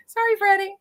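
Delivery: high-pitched apology to a dog; playful high pet-directed voice; talking to a pet. Real, not acted. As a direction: coo sorry to the pup, way up high.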